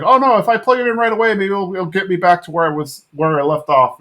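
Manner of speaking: high, fast mock panic; panicked dad. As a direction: rattle it off, half-hammed alarm. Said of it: "and Hank's like".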